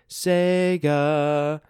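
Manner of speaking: high, loud, sung-out startup chime; Sega jingle voice. Retold or imitated. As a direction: belt it like the old logo call.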